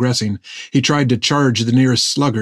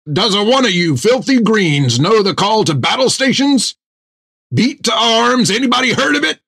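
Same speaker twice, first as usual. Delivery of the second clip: angry yelling; 10 semitones higher than usual. A narrator's reading. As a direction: tear into the whole crew. Jack berates crew.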